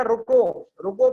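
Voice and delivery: रुको said loud, then a break; pause after रुको. Shown as a demonstration. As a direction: stop firmly on रुको as if at a comma. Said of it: "पे comma लगा दिया".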